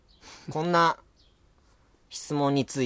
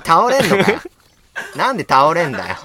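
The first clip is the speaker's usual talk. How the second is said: laughing retort; 5 semitones higher, 7 dB louder than usual. Real, not acted. 笑いながら強くツッコむ.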